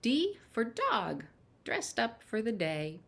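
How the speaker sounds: bright, lilting picture-book reading; reading to children. Acted. Storyteller reads the alphabet book to children, lilting. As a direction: read each letter like a treat for little ones.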